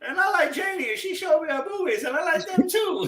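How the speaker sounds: fast, giggly Bobby Boucher; high hammed-up Waterboy voice; Waterboy impression. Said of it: "quoting Waterboy".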